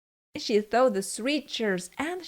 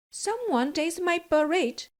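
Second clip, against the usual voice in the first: higher, mild motherly bear voice; average Mama Bear voice; 6 semitones higher than usual. A narrator's reading. Mama Bear finding her porridge touched, higher voiced. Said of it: "said Mama Bear in her average voice".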